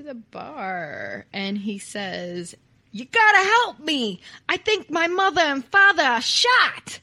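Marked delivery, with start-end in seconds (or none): 2.9-7.0 loud, high Brooklyn cry for help; amateur Brooklyn impression, urgently projected; hammed-up Brooklyn accent. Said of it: "If you're from Brooklyn, please don't be offended by this accent".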